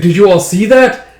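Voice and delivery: loud, high taunt to a crowd; jeering villain. Acted. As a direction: crow to the onlookers.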